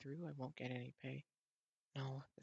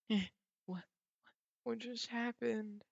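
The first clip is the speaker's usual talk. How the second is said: baffled; 8 semitones higher, 4 dB louder than usual. Real, not acted. Confused at sudden scene.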